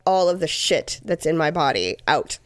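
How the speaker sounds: normal talking voice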